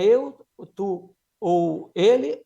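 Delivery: very slow, pausing on "tu" and "ele"; teacher walking through pronouns; slow person list. Shown as a demonstration. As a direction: count off the persons deliberately. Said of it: "qual pessoa".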